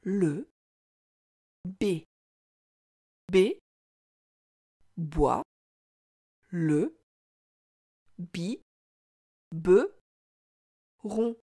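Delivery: "Le B B boit le bi be ron", each syllable alone with long pauses. Shown as a demonstration. Teacher reads along with child, syllable by syllable. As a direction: read it out very slowly, one separate beat per syllable. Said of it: "Essaie de lire cette phrase avec moi".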